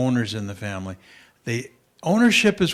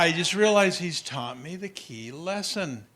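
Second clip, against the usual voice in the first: normal talking voice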